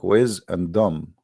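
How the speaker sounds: "wiz and dumb" slowly, as separate words; word split apart. Shown as a demonstration. Mocking the word wisdom to his audience, split slowly. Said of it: "for me, it looked like".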